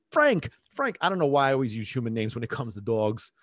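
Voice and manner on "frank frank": high, raised call of a name. Retold or imitated. Owner calling the barking dog's name, high mock call. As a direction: call the dog in mock exasperation. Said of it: "they're calling their name".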